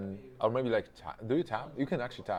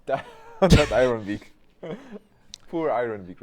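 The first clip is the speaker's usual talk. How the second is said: shocked laughter; 2 semitones higher, 10 dB louder than usual. Real, not acted.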